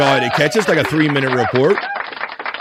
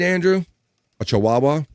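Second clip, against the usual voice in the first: ordinary delivery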